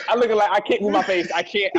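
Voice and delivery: talking through laughter; giggling while speaking; laughing. Real, not acted. Laughing while retelling numb face.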